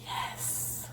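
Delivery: a soft whispered yes. Real, not acted. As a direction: breathe the yes out. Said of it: "Why am I whispering?".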